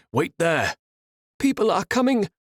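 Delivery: urgent shout from across the bridge; shouted back. Acted. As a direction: yell it across the gap. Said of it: "Safiri shouted back".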